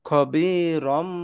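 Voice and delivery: খবিরম read very slowly, the nasal ম held long; খবিরম drawn out at a very slow pace with a held nasal ম; iqlab reading. Shown as a demonstration. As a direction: stretch খবিরম out slowly, ending on a long humming ম. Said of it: "তাহলে এখানে আমরা পড়বো".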